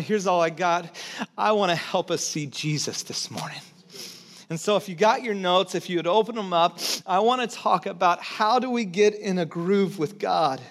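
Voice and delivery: ordinary talk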